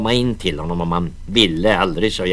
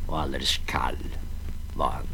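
hushed whisper; 7 semitones lower, 10 dB quieter than usual. A narrator's reading. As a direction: let it fall to a whisper. Climax of grim tale.

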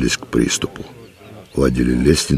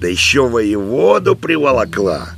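high, indignant cry; old man's yell; 9 semitones higher than usual. A narrator's reading. Old man sees his wife brought the commander, indignant yell. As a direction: complain in a raised, scornful voice. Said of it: "завопил он".

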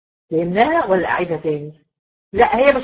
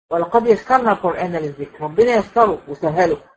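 ordinary talk

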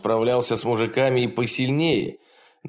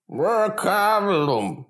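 high, very slow gurgling snort; gurgling creature voice; 11 semitones higher, much slower than usual. A narrator's reading. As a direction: snort the name like a wet beast. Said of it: "при звуке булькающего голоса".

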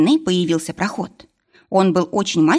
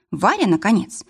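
plain reading voice

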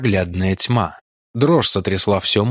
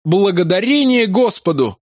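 higher cry full of hope and relief; relieved cry; 10 semitones higher than usual. Acted. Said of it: "вскричал Трок-Мартин". (радостный возглас облегчения)

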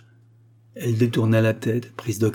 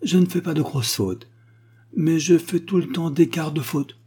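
put-on high little-girl voice, full of conviction; 8 semitones higher than usual. A narrator's reading. Little girl boasts to her father, with conviction. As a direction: state it firmly in a child's higher pitch. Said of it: "dit avec conviction".

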